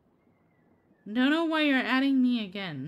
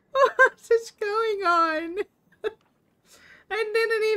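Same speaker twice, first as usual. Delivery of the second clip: baffled laughing cry; 10 semitones higher, 6 dB louder, slower than usual. Real, not acted. Baffled by an unexpected request.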